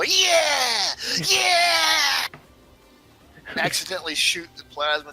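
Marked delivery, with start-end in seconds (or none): 0.0-2.3 high, loud wild whoop; whooping yell. Acted. Player waving a plasma rifle, high whooping yell. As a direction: yell it out like a triumphant war cry.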